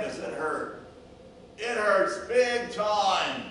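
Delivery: loud, high, drawn-out calls of distress; hurt and pleading, projected far; crying for help. Acted. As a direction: call out slowly and loudly for someone to come. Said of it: "your plea and your cry for help".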